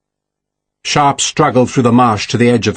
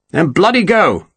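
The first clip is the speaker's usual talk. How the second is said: higher-pitched curt command; impatient order; 6 semitones higher than usual. A narrator's reading. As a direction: order them off, exasperated. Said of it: "Picton ordered".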